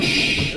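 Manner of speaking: soft hushing "shh"; shushing. Acted. Client hushing the squawking nail lady, shushing. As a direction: hush her quickly.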